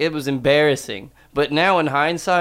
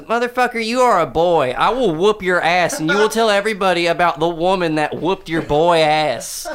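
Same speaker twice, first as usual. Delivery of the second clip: high, loud hammed-up threat; aunt threatening a grown man, mock furious; 4 semitones higher, 4 dB louder than usual. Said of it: "She goes".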